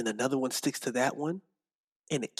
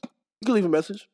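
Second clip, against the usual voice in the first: higher, louder answering-machine line; voicemail greeting; 7 semitones higher, 6 dB louder than usual. Pretend. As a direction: play the phone message.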